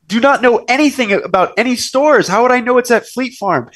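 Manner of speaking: high-pitched, insistent retelling of a protest; exasperated protest. Retold or imitated. Retold exasperated protest to mother.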